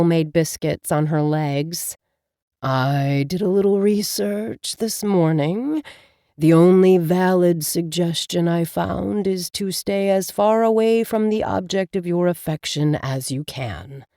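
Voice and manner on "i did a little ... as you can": talking cat Abel. Acted. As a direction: speak as the wise, patient cat familiar. Cat familiar Abel speaks.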